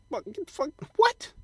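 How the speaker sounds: high, squeaky mock disbelief; shocked reaction. Comic seeing an alien-looking dating photo, high shocked squeak. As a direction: recoil in mock horror. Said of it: "I was like".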